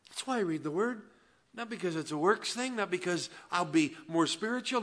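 loud, high, driving sermon voice; full-force preaching. Real, not acted. Pastor preaching hard to his congregation, loud and high. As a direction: preach it out with fire.

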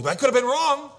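very high, loud wail; panicked doubt. Acted. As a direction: wail like a husband losing his nerve, hammed up. Acted panicking husband in doubt.

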